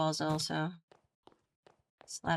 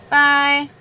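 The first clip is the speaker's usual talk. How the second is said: loud bye; 5 semitones higher, 12 dB louder than usual. Acted. Loud farewell.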